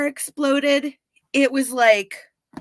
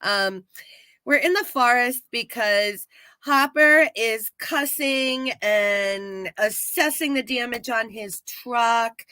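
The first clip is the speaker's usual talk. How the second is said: ordinary delivery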